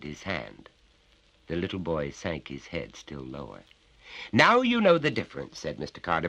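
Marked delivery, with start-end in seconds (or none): 4.3-5.6 higher, louder bullying father; menacing father. Acted. Father grips his son's neck, bullying and loud. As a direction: lean on the child with each claim.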